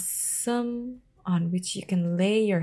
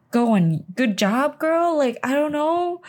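egging her on with a cheer; 7 semitones higher, 5 dB louder than usual. Real, not acted. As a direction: enthusiastic cheer for the heroine. Cheering the character on.

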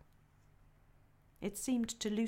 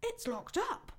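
high, polite little voice; a hint of a bashful beaver wife; lightly voiced Mrs Beaver; 14 semitones higher, slower than usual.

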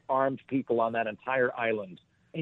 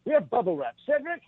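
British guard calling; 11 semitones higher than usual. Retold or imitated.

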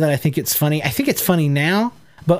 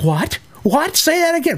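rising from usual pitch to high incredulity; baffled, demand a repeat; mock astonishment, pitched high; acted disbelief; 12 semitones higher than usual. Acting out his first astonished reaction.

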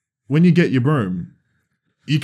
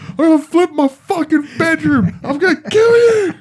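shrieked, much higher and louder, half laughing; mock screaming rage; 8 dB louder than usual. Threatening the friend who pre-ordered, in a laughing shriek. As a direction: scream like a furious kid. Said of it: "I was like".

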